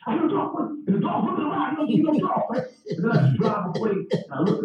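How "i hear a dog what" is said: high, startled dog; lightly voiced startled dog; alarmed dog voice. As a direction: voice the dog hearing a bark. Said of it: "dog, like".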